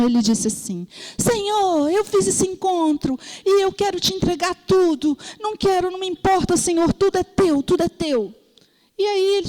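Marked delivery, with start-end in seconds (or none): 1.2-8.3 higher-pitched, acted-out surrender to God; earnest mock prayer, pitched up and insistent; fervent prayer. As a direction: imitate an eager believer offering up everything. Acts out young man's fervent prayer.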